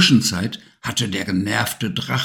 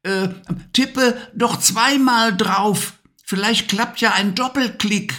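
put-on boy's voice; 11 semitones higher than usual. A narrator's reading. Levi suggests a double-click to the witch in a high boy's voice. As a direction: voice the boy helpfully advising. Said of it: "meinte Levi".

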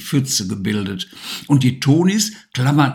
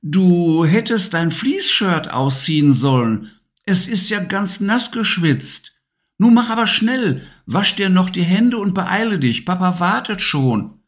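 a hint of a brisk mum, a touch higher; lightly voiced urging mother; 8 semitones higher than usual. A narrator's reading. Mother urges Levi to wash his hands, lightly voiced. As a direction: hurry the child along like a mum. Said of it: "forderte Mama ihn noch einmal auf".

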